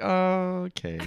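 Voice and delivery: high, drawn-out wary okay; skeptical okay. Retold or imitated. Viewer reacting to recreated episodes, high skeptical okay. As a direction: stretch it out, humoring with doubt.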